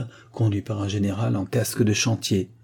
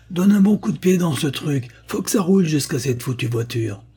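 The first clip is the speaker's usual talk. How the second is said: urgent order; 7 semitones higher, 3 dB louder than usual. A narrator's reading. Mother urging her son.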